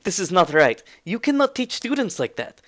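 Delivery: put-on high-pitched, protesting Russian accent; Russian-accented lecturing objection. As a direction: argue firmly in a Russian accent. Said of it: "a familiar Russian voice saying".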